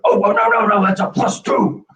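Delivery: high-pitched, insistent imitation of a heckler; increasingly aggressive butting-in, exaggerated high voice; mock aggressive correction. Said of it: "more and more aggressive with his tone".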